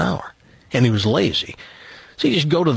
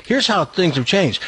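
ordinary talk, nothing marked

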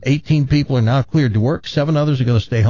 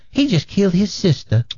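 put-on Southern accent; 4 semitones higher than usual. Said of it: "with a thick Southern accent".

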